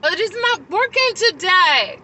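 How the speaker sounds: high, loud, slowed protest; exasperated protest, replayed; retold upset. Speaker at the restaurant door, upset at giving in, retold protest. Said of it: "I was very upset".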